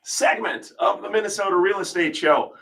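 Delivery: high, projected live-radio welcome; radio show intro. Real, not acted. We're going back live on air.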